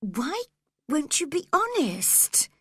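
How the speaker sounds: put-on upset girl crying out. A narrator's reading. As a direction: demand honesty, voice rising. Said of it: "cried Cathy at once".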